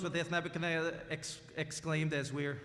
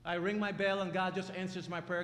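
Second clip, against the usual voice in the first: ordinary talk, nothing marked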